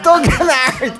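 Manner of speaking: half-laughing mock advice; chuckling punchline delivered high; laughing joke. Real, not acted.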